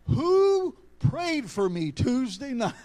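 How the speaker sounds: slow, high, loud demand. Retold or imitated. Preacher voices daughter pressing her father, demanding. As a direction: draw the question out with rising force.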